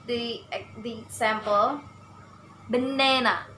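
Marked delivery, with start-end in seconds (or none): 2.7-3.5 baNAna, middle syllable raised then falling; stress on middle syllable. Shown as a demonstration. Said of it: "Only ne have to stress".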